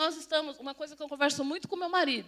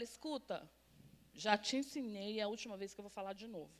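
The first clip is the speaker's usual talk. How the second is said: low, softer firm warning; restrained mother laying down a last warning; quietly stern, imitated; retold motherly warning; 5 semitones lower, 8 dB quieter than usual. Mother voicing her warning to daughter.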